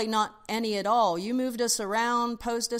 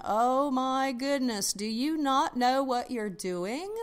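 slow, higher-pitched mock dismay; appalled client; 5 semitones higher, slower than usual. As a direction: imitate a client in drawn-out disbelief. Voicing clients' dismay at photographer.

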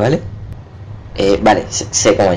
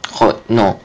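usual speaking voice

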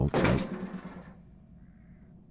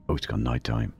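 ordinary talk, nothing marked

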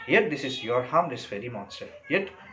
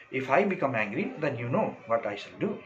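ordinary talk

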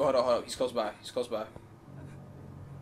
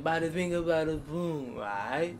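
attempted Italian accent; 4 semitones higher, slower than usual. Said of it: "I meant the Italian. Can't do Italian accent".

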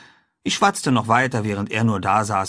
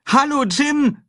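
high, loud cheerful call; boy springing out of hiding, calling loudly; 5 dB louder, slower than usual. A narrator's reading. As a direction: call out to a friend across the clearing. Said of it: "rief ich und sprang hervor".

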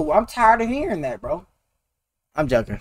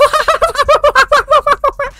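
high shrieking laugh; loud laugh; 3 dB louder than usual. Real, not acted. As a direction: burst into a screaming laugh.

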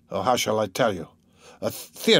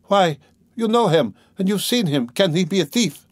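high, urgent plea; imploring; 7 semitones higher than usual. A narrator's reading. Dunya begs Svidrigailov to clear her brother, imploring. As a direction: plead with raised pitch, desperate to be agreed with. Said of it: "She seemed to be imploring Svidrigailov".

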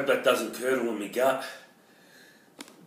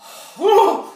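high pained gasp; 7 dB louder than usual. Real, not acted. Gasping from heat.

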